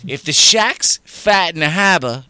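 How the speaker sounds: If the Shaq's fat in the harbor, loud and slow with heavy Boston vowels; broad Boston accent. Shown as a demonstration. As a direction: hammer the Boston accent home. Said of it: "you're Boston".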